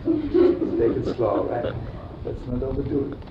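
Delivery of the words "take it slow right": laughing. Acted. Mocking slow modern dating.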